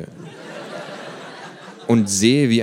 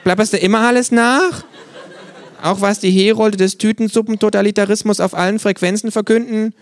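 much higher, louder kangaroo, laughing scorn; mocking kangaroo; 8 dB louder than usual. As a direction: jeer at him, pitched right up and loud. Kangaroo character voice.

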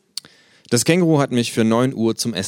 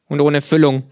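mouth full; 8 semitones higher, 8 dB louder than usual. Acted. Kangaroo character voice.

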